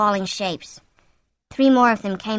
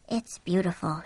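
murmured softly under the breath; 2 semitones lower, 6 dB quieter than usual. A narrator's reading. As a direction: murmur it, hushed. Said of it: "she murmured".

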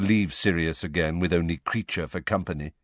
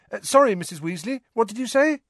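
high, quick, half-listening apology; distracted boy; faster than usual. A narrator's reading. Harry looks up from the chess game, distracted. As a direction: pull your attention back from the game.

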